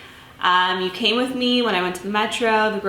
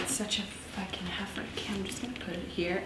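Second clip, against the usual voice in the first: fast, hushed whisper; 14 dB quieter than usual. Real, not acted. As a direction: whisper it quickly to yourself. Disgusted, handling raw turkey.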